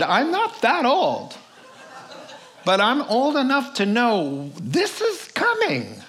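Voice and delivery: put-on cranky old-timer teasing youngsters; wagging finger at whippersnappers; old prospector. Teasing young listeners about old age.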